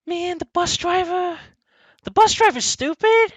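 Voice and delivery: a high, loud rant at the stupid bus driver; exasperated outburst. Real, not acted. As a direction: blurt it out as if you can't believe how dumb he was. Incredulous rant about bus driver.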